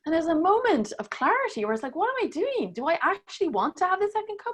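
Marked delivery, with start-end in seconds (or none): 2.0-4.5 higher, incredulous self-questioning. Retold or imitated. Woman recounts doubting whether to take a second cup, incredulous. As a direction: question yourself in a higher, disbelieving voice. Retold moment of doubting herself.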